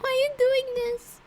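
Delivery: high, wavering voice close to tears; struggling to hold back sobs; unsteady tearful voice. Acted. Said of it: "trouble keeping her voice steady".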